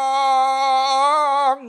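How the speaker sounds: "Yang" called out extremely drawn-out; very long endearment. Retold or imitated. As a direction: stretch "Yang" out on one long held note. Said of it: "Panjang bukan main".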